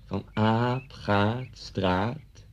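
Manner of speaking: very slow, drawn-out syllables; reading lesson. Retold or imitated. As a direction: imitate a teacher spelling out words to the class. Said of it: "En u maar lezen". (voorlezende meester, heel traag en gerekt)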